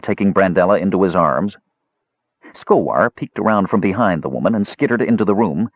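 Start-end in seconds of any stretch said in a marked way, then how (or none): none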